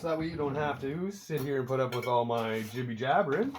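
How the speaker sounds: ordinary talk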